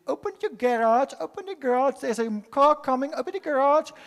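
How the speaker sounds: daughter-in-law playfully coaxing the grandchild; coaxing a toddler to eat. Retold or imitated. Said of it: "and she said".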